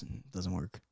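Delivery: ordinary talk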